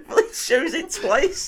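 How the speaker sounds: very high, slow laughing protest; creased with laughter. Real, not acted. Man insists to friend about film, helpless laughter. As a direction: squeeze it out through real laughter. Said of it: "I was fucking creased off it".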